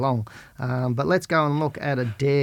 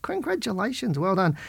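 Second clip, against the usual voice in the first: excited congratulations; 7 semitones higher than usual. Real, not acted. Congratulating a new trader on his first stock, excited and high. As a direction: pitch jumps way up, bright and pleased.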